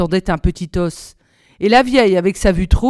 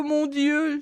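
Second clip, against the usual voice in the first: the second mon Dieu much softer, dying away; fading moan; 6 semitones higher, 7 dB quieter than usual. A narrator's reading. Margot moaning to God, the repeat fading quiet. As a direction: let mon Dieu sink to a quiet wail. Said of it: "gémissait-elle".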